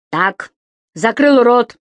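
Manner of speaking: громко оборвать: закрыл рот; прикрикнуть, чтобы замолчал; резкий приказ. A narrator's reading. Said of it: "скомандовал господин Дауме". (Дауме приказывает Субастику замолчать, громко и резко)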